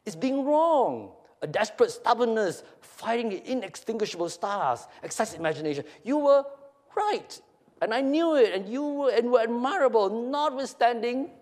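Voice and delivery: high, mounting mock admiration; theatrical high-pitched sneer; snickering taunt. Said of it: "he snickers".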